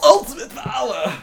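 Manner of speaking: high mock battle shout; anime attack cry. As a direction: call out a special move, pitched high.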